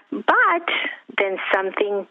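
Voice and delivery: high, slow voice choking up with real tears; tearful. Real, not acted. Guest on a talk show nearly crying over her miracle, slow and high. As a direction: say it through welling tears, slowly. Guest moved while telling testimony.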